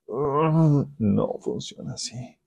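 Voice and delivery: soft, slow acted dismissal; quiet refusal. Preacher voicing the Lord answering hopeful believers, quietly refusing. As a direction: hum first, then softly turn it down.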